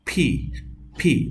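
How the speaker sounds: "ফি" high with a puff of air; p mixed with ph; aspirated model. Shown as a demonstration. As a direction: release breath sharply on "ফি". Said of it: "পর ফ মিশ্রিত".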